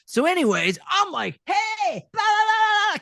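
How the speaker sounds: very high, theatrical mock babble; all over the place. Actor showing how he misused the microphone, wildly over the top. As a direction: imitate your overexcited self, voice leaping way up. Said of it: "all over the place and stuff like that".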